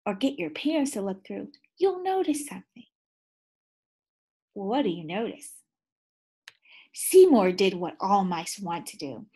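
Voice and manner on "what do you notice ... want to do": plain reading voice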